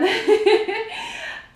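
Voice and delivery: high, loud giggling laugh; burst of laughter. Real, not acted. Laughing at her own joke to viewers, high loud giggle. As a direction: break into a giggle. Laughs mid scotch review.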